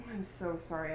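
ordinary talk, nothing marked

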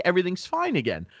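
normal talking voice

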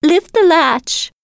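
put-on high, slow old woman's call; frail grandmother calling. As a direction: call out weakly like a sick granny. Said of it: "called out the grandmother".

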